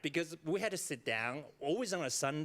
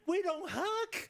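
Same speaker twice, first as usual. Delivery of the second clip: much higher, emphatic we don't hug; mock indignant squeak; 3 dB louder, slower than usual. Speaker joking about her Taiwanese family, high mock protest. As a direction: play up the shock at hugging.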